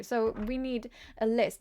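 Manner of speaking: ordinary talk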